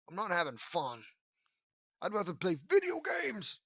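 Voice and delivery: real exasperation rising in pitch; exasperated whine. Real, not acted. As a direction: whine that you'd rather be elsewhere. Reader complaining about the story.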